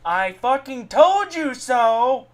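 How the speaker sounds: ordinary delivery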